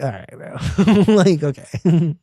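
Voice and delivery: slow, high, laughing unimpressed reaction. Retold or imitated. Fan reacting to the lyric, laughing and unimpressed. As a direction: chuckle it out slowly. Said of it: "even that was like".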